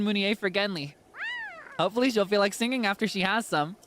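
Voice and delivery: put-on a flustered kid who forgot rehearsal; excited kid. Voices the fishing kid.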